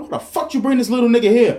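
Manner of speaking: high, loud half-laughing snap; hot-headed question fired off through a laugh; retold angry outburst. Retelling a rude customer at work, mock furious. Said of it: "I was hot".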